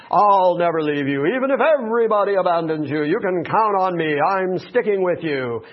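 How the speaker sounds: mock-swaggering promise, punched out; braggadocious. Peter vowing loyalty to Jesus, mock braggadocious. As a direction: brag it like a man full of himself. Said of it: "saying things that are braggadocious about himself".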